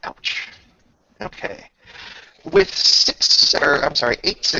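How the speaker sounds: ordinary talk, nothing marked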